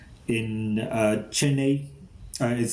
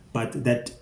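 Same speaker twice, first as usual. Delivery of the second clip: ordinary talk, nothing marked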